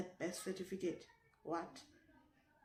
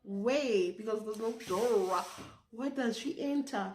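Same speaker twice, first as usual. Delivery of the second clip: loud, incredulous retold protest; indignant protest; 6 dB louder than usual. Accused pupil answering the teacher, indignant protest. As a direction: protest loudly, as if the charge is absurd.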